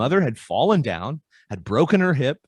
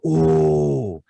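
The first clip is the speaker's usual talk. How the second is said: gentle therapist question; much slower than usual. Retold or imitated. Therapist voicing own gentle question.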